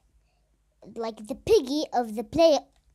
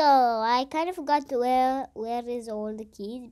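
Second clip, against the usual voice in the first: usual speaking voice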